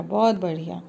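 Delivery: usual speaking voice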